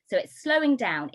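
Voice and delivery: ordinary delivery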